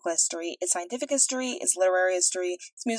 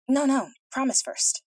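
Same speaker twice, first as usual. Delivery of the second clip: insistent princess; 6 semitones higher, 3 dB louder, slower than usual. A narrator's reading.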